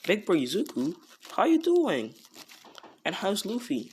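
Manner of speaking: overjoyed little brother picking up the call; excited greeting. Retold or imitated. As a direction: burst out happily at big bro.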